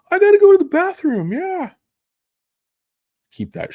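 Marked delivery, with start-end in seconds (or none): 0.1-1.7 put-on much higher, louder little boy whine; high squeaky kid excuse. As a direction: pipe up like a kid desperate to escape. Kid voice during skins game.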